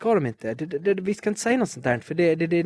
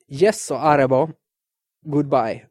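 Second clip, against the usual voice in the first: ordinary talk, nothing marked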